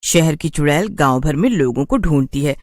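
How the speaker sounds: ordinary delivery, nothing marked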